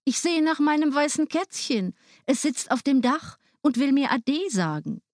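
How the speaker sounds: put-on the boy excusing himself sweetly; lightly voiced little boy; young Hänsel. A narrator's reading. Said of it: "sagte Hänsel".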